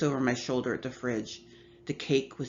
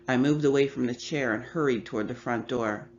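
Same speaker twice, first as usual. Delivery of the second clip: plain reading voice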